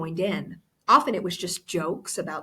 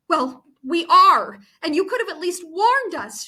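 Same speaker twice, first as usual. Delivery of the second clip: bitter retort; 8 semitones higher, 6 dB louder than usual. A narrator's reading. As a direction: throw it back at her. Angry friend retorts.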